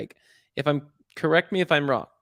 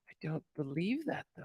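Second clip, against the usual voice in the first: self-doubting aside; 14 dB quieter than usual. Retold or imitated. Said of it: "but I'm like".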